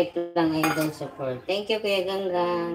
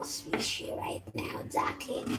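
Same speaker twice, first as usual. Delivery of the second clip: soft playful whisper; 12 dB quieter than usual. Real, not acted. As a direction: lean in and whisper it. Sudden whisper to viewer.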